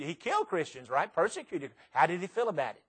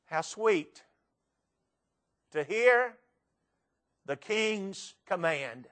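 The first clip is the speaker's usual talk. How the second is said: slow, measured recited verse; poem recitation; slower than usual. Acted. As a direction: recite it like a pulpit poem, weighing each line.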